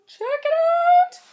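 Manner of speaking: slow, high, loud squeal; excited squeal. Real, not acted. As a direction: squeal it out with delight. Showing off, repeated.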